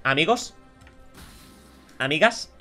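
normal talking voice

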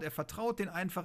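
normal talking voice

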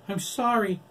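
high, loud tearful cry. A narrator's reading. Kelly crying out after killing Michael. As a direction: cry it out in anguish. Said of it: "he cried".

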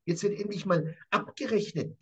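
ordinary talk, nothing marked

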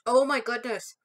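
chewing while talking; eating a mint treat; mouth full. Real, not acted. Seen 0: Bit the treat at -9; mouth working while talking, piece in hand.

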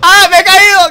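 high, loud yelp of falling; sudden loud wail of dismay; dismayed shout. Real, not acted. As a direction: cry out as you drop. Falls in the game.

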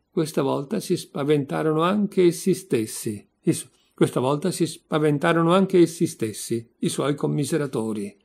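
ordinary delivery

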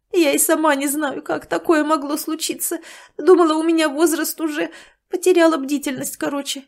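a hint of a worried woman; flustered woman.

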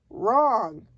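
loud, drawn storybook cry; emphatic loud correction. A narrator's reading. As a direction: call it out loudly as the giant. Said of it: "cried the giant".

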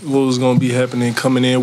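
usual speaking voice

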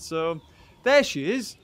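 usual speaking voice

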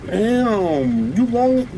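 stunned outburst at a huge creature; shocked. Real, not acted. Shocked at a tall monster.

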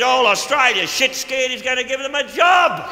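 big comic yell; shouted punchline. Acted. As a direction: belt the gag to the back row.

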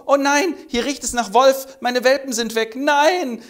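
high, loud anguished cry; hammed-up despair; distraught vixen. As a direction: discover the empty den in horror. Vixen finds cubs gone.

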